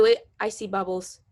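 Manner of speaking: ordinary delivery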